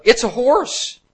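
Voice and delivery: high, loud heckler's shout; a woman's sudden yell from the crowd. Retold or imitated. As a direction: blurt it out from the audience. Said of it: "a woman yelled out".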